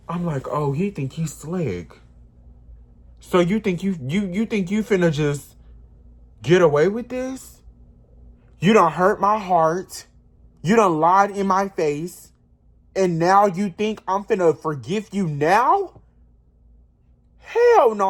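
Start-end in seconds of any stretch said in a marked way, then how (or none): 3.2-16.0 retelling a furious confrontation, pitched up and loud; livid. Retold or imitated. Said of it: "I was livid". 17.4-18.1 a sudden shout, much higher and louder; yelled hell no at a cheater; furious refusal. Retold or imitated. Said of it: "I was livid".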